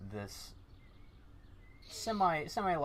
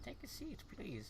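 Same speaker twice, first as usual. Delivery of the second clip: fortune teller; 11 dB quieter than usual. Acted. Fortune teller invites seat.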